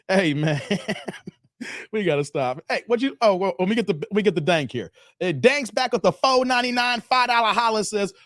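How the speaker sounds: normal talking voice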